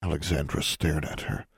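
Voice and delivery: usual speaking voice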